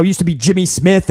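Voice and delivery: normal talking voice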